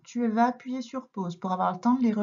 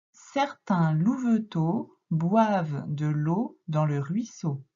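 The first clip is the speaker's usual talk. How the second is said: slow, syllable-by-syllable reading; slow read-aloud; 2 semitones lower, slower than usual. Shown as a demonstration. Teacher reading slowly so the pupil can follow. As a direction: spell out the sentence slowly for a beginner. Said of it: "quand tu vas lire".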